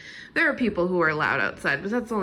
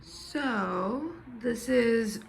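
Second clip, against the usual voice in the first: raspy smoker's voice; hoarse, a bit rough; 2 semitones higher, 4 dB quieter, much slower than usual. Real, not acted. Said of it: "sound like a smoker".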